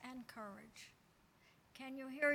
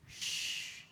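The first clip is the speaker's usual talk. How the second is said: whispered hush. Acted. Hushing the congregation.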